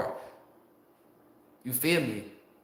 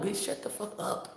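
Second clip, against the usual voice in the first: softer, breathy dismissal under the breath; whispered put-down; 3 semitones higher, 10 dB quieter than usual. Acted. Dismissing an Instagram commenter, whispered. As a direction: hiss it off to the side.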